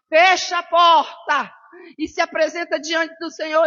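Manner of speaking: loud, slow, preaching at full force; preaching shout. Real, not acted. Quoting scripture to the congregation, shouting in preaching style. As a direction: proclaim it like a pulpit climax.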